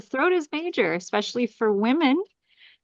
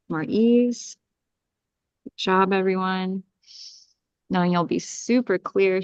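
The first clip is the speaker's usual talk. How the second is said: guided clearing; 3 semitones lower than usual. Acted. Healer guiding the group through an energy clearing, calm meditation voice. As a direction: ease the group through each breath and command.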